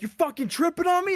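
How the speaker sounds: sergeant yell. Retold or imitated. Sergeant yelling at recruit who fell, loud. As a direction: snap it at the fallen recruit. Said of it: "they're like".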